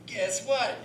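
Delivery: normal talking voice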